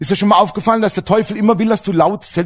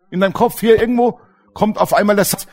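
usual speaking voice

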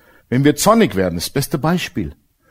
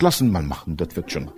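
calm God; 10 semitones lower, 6 dB quieter than usual. Retold or imitated. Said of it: "Gott bleibt immer auf dem Thron und sagt".

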